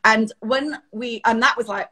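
normal talking voice

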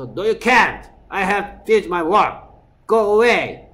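shouting dad at home; angry dad yelling. Retold or imitated. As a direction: shoo the daughter away, furious. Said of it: "이러죠, 우리 집은".

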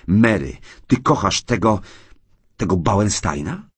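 desperate plea. Acted. Husband grabs wife's hand, desperate question. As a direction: ask as if dreading the answer.

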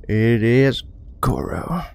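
slow, stretched mock announcer reveal; drawn-out announcement. Player revealing who's next, drawn-out mock announcement. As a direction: draw out the opponent's name grandly.

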